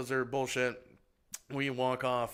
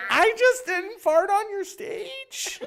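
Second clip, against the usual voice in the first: squeaky, drawn-out loud reply; hammed-up high squeal of a question; high-pitched mock answer; 6 dB louder, much slower than usual. Mock-innocent answer to the auditioner.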